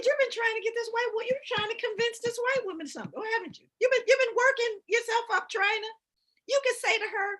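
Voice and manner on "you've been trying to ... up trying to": mock exasperated. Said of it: "I said".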